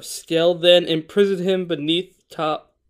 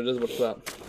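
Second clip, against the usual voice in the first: holding back a sneeze; 3 semitones lower, 6 dB quieter, faster than usual. Real, not acted. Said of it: "I'm trying to sneeze".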